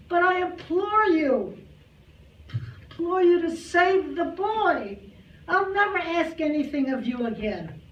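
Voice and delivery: high, loud desperate plea to the heavens; frantic pleading. Acted. Grandmother begs heaven to save her drowning grandson, frantic plea. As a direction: beg God as if the child depends on it.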